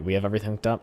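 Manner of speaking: ordinary talk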